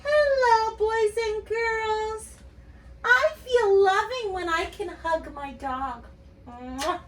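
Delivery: high, sweet, slow greeting; loving monster. Pretend. Teacher as the pink monster greeting kids lovingly. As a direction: coo lovingly at the children. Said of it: "tell what makes you feel loving".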